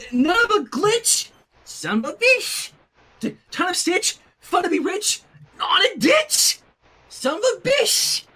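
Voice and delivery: playful rhymes. Real, not acted. Reader riffs rhyming variants.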